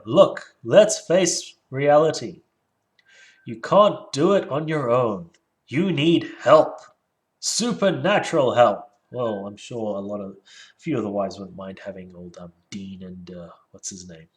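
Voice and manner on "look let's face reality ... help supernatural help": emphatic preachy reading. Retold or imitated. Reading the author's advice aloud.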